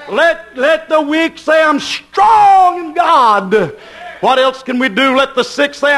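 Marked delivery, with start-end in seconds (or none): 0.1-3.7 slow, high, loud call; preaching shout. Real, not acted. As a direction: drag it out and shout it to the rafters. Preacher leading a congregation response.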